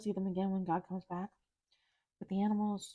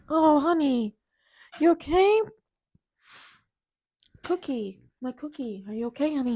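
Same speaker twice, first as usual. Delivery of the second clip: high, loud, anxious cooing; worried parent; 7 semitones higher, 11 dB louder than usual. Real, not acted. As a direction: fret over her like a scared parent. Worried talk to her cat.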